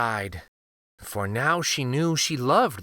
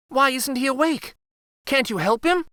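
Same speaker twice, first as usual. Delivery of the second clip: upset; 11 semitones higher than usual. Acted. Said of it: "Katya cried".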